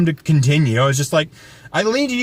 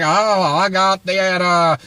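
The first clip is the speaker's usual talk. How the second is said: bad Louisiana accent. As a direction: play a Louisiana storekeeper, badly faked accent. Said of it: "I can't do a Louisiana accent".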